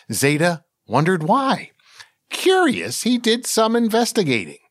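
ordinary delivery, nothing marked

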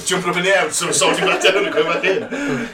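talking through big laughter; laughing. Real, not acted. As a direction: laugh while describing it. Imagining Yoda on the job.

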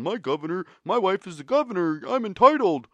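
high, mocking, swelling to a shout by the end; mocking husband. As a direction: whine it out like a smug, spoiled husband. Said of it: "her husband going around".

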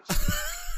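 high wild cackle; wild rambling cackles. Real, not acted. Cackle during old-man bit.

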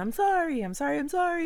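over-apologize brightly; gushing apology. Retold or imitated. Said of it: "exclamation points".

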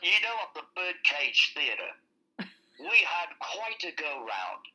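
slow, posh-sounding mock English accent. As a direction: put on a playful English accent for the story. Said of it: "I should have talked".

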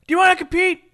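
loud, high mock question; hammed-up loud question; acted challenge. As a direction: call out the challenge, imitating the exchange. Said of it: "Rey says he wants to compete".

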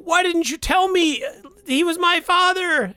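high-pitched whiny Luke, hurt and pleading. Retold or imitated. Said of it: "Luke is like".

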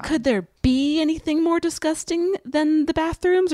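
hint of a sarcastic sitcom cadence; Chandler-like line reading. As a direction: quote it with a mock punchline lilt. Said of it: "Are you Chandler from Friends?".

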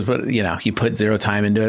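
usual speaking voice

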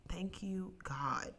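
hushed relieved prayer; grateful whisper. Retold or imitated. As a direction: breathe out a thank-you prayer. Retold relief at money.

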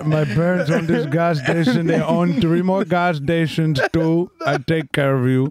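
hammed-up Indian accent, eager suitor; mock Indian accent. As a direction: promise the world in a comic Indian accent. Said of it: "That's very good".

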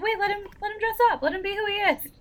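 high-pitched playful pleading; mock encouragement. Viewer urges others to let the character dress up, playfully encouraging. Acts own cheering on the character.

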